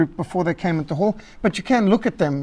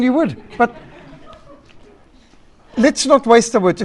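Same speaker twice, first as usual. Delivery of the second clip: usual speaking voice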